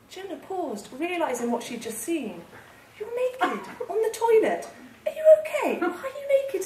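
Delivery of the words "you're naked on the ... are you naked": high, incredulous questioning; taken aback. A narrator's reading. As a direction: ask in high-pitched bewilderment. Jenna taken aback at naked friend.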